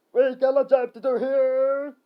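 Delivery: slow, high, loud shout. Acted. Third skeleton reminding them of the job, shouting. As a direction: shout it, dragging the words out. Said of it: "A third shouted".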